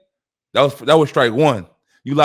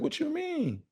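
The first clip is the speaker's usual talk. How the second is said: surprised, high-pitched question; 14 semitones higher, 15 dB quieter, slower than usual. Retold or imitated. Confused reply to the girls.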